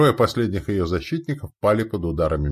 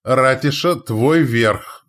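loud command; shouted order; 5 semitones higher, 6 dB louder, much slower than usual. A narrator's reading. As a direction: call out the order.

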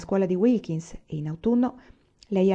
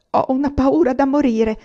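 trembling, scared, pitched higher; nervous girl before an exam, trembling voice; frightened murmur; 7 semitones higher, 5 dB louder than usual. A narrator's reading. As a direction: let the fear shake the words. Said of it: "mormorò Florence. Le tremava la voce".

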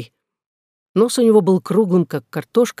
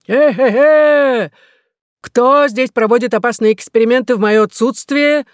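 thunderous voice; 6 semitones higher, 5 dB louder than usual. A narrator's reading. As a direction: boom it from on high. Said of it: "послышался громовой голос".

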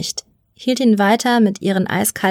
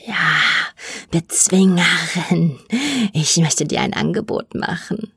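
sinister mutant; 3 semitones lower than usual. A narrator's reading. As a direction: draw out the offer with menace. Mutant makes an offer.

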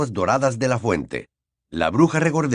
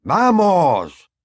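higher, louder impatient call; impatient shout; 7 semitones higher, 5 dB louder than usual. Acted. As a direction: urge them on sharply. Impatient at the lift.

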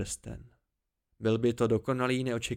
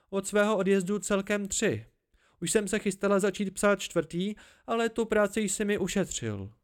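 higher, lighter woman's voice, chatty; young woman's voice; 6 semitones higher than usual. A narrator's reading. Young woman telling a friend about her letters, lighter voice. Narrator voicing the female lead.

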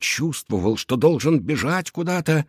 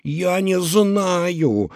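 upset, high and drawn out; flaring up; 7 semitones higher, much slower than usual. Acted. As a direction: snap back, wound up. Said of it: "взвился Шустрик".

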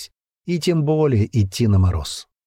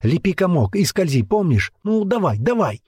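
urging; 8 semitones higher than usual. A narrator's reading. Girl urging terrified boy on.

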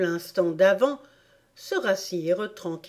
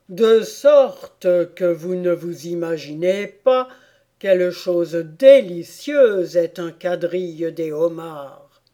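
lightly voiced sad turtle, louder; sorrowful turtle; 7 dB louder than usual. Turtle questions Alice about life under the sea, lightly voiced sorrow. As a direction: speak up as a doleful storybook turtle.

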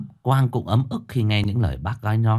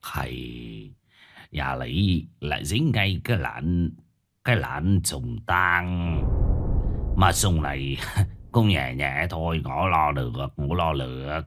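put-on low, slow, hushed old master's voice; grave old master; 5 semitones lower, 4 dB quieter, slower than usual. A narrator's reading. Old master diagnoses a chain-death curse, grave and slow. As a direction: weigh each phrase, low and soft. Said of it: "vẻ mặt của lão trầm trọng".

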